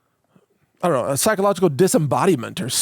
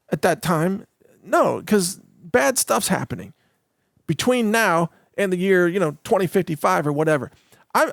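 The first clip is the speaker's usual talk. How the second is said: ordinary delivery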